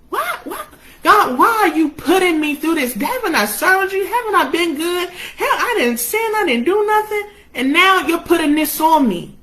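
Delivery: high-pitched, pleading protest; questioning God. Acted. Job asks God why he suffers, anguished questioning. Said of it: "but he was questioning".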